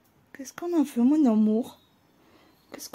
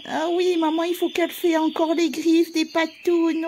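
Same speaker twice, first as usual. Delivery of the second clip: ordinary talk, nothing marked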